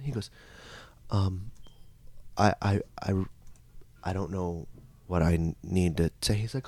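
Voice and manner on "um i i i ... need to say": soft, low, stammering hesitation; halting, unsure mumble; hesitant child. Retold or imitated. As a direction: falter quietly, with long pauses. Acts hesitant child to priest.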